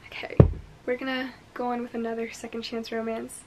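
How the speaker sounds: soft unvoiced whisper; hushed conspiratorial aside. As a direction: breathe it like a secret. Whispered transition to next book.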